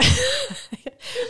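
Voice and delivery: a burst of high laughter. Real, not acted. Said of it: "laughs at story".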